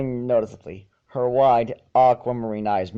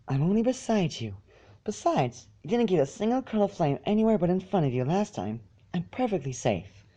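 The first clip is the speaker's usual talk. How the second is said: soft pony voice; 6 semitones higher, 5 dB quieter than usual. Acted. Fluttershy voiced in story reading.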